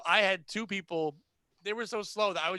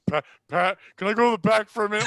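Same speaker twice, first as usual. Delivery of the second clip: louder, urgent request holding laughter in; straining not to burst out laughing; imitated stifled laughter; 2 semitones higher, 9 dB louder than usual. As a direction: imitate someone about to crack up. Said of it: "he's trying to just crack up".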